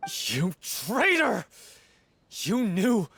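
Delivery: slow anguished accusation. Acted. Accusing Viamant after his confession.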